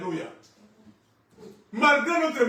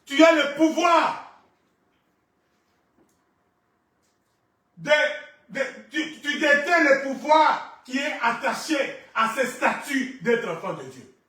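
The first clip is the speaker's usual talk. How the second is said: raised, ringing declaration to the congregation; forceful preaching; 6 semitones higher, 7 dB louder than usual. Real, not acted. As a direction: proclaim it loudly, stretching key words.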